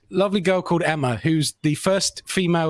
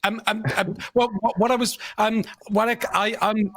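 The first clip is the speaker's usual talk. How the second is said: higher-pitched, flustered stammering; nervous stammer; 5 semitones higher than usual. Retold or imitated. Nervous questioner put on the spot, mock stammer. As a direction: keep restarting and fumbling for the words. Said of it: "I stammered through the question".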